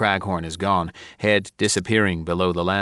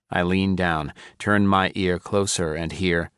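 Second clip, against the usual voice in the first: plain reading voice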